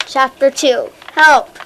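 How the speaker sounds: loud cry for help; the chapter title called out as a cry; shouted title. A narrator's reading. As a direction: belt the title out.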